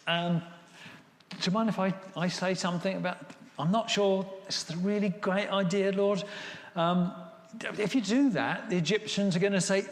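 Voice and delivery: acted polite doubt with halting starts; tactful objection. Moses tactfully objects to God.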